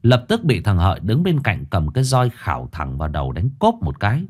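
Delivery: usual speaking voice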